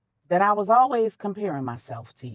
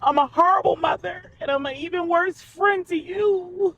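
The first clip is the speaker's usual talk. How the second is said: tearful; 8 semitones higher than usual. Acted. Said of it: "Regina was in tears".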